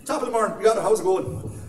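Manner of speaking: high, loud, hammed-up Irish greeting; exaggerated Irish accent. Irishman charming Americans, exaggerated Irish accent. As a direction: ham up the Irish to the limit. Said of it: "turn my Irish accent up to eleven".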